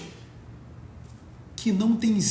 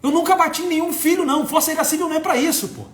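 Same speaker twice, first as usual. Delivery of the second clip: emphatic rant; 8 semitones higher, faster than usual. Retold or imitated. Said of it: "Ele falou".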